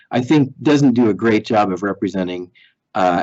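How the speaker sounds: normal talking voice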